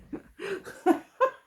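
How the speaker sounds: bursting into a high loud laugh. Real, not acted. Reader laughs at herself after kicking the table, high laugh.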